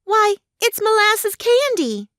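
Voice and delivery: very high, drawn-out surprise; girl's exclamation. A narrator's reading. Trot examines her plate, high astonished girl's voice. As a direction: exclaim it as an astonished child, pitched right up. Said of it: "the little girl exclaimed".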